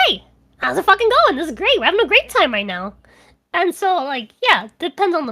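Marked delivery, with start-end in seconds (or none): 0.1-2.9 higher, louder hyped streamer greeting; really energetic. Retold or imitated. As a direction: burst in full of energy, fast and bright. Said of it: "I'm really fucking energetic".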